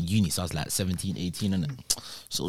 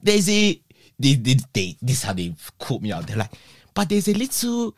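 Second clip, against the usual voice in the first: put-on the boys catch him out with a Nigerian accent; the local boys quizzing him in a Nigerian accent; 10 semitones higher, 5 dB louder than usual. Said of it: "Nigerian dialect accent".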